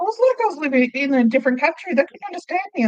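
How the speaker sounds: high, incredulous complaint; mock exasperation. Customer recounting not being understood, exasperated. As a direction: pitch it up in disbelief. Retelling frustration at being misunderstood.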